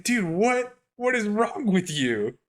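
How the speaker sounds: incredulous. Retold or imitated. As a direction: stretch it out in baffled outrage. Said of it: "It's like".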